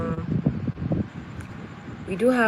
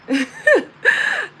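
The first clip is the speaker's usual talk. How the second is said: a sudden high-pitched squeal of laughter; 9 dB louder than usual. Real, not acted. Laughing loudly at how weird the Ukrainian phone greeting felt. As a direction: burst into a shrill, loud laugh. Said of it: "that was very weird to me".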